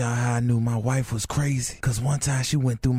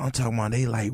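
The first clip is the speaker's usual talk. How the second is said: normal talking voice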